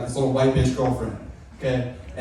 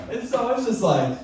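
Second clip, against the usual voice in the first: ordinary delivery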